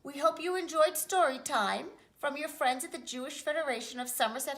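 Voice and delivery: bright sign-off read; closing announcement. Acted. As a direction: wrap up story time cheerfully.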